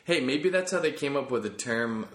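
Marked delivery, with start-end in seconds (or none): none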